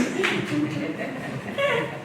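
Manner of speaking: a short high laugh at herself; amused laugh. Real, not acted. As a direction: laugh at your own joke. Jokes about not knowing her poems.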